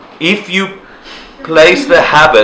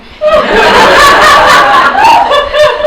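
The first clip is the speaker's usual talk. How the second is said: high, loud laugh at her own story; high burst of laughter; 6 dB louder than usual. Real, not acted. Teacher laughs hard at her own story, a high loud laugh. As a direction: break into a big laugh.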